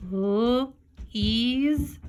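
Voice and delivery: L, then EASE as "eez", apart; sounding out long E. Shown as a demonstration. Teacher trying long E first, L and EASE sounded out apart. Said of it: "the long E sound first".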